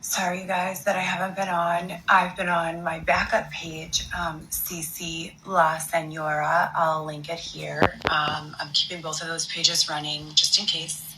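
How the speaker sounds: hushed, slow, soft-spoken apology. Acted. Apologizing to followers for being away, soft and slow. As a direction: coo a sorry to your followers. Recording apology video again.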